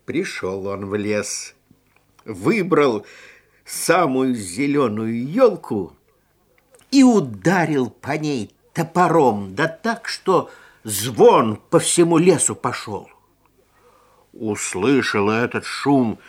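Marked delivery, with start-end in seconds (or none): none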